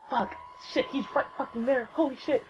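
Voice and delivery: high, hushed starstruck panic; freaking out. Retold or imitated. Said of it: "I was kind of freaking out".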